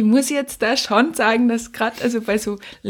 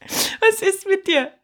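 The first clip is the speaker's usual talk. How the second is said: very slow and higher, with a laugh; laughing again in disbelief; 10 semitones higher, much slower than usual. Real, not acted. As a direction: stretch the question out while laughing. Rit après la menace de Lukas.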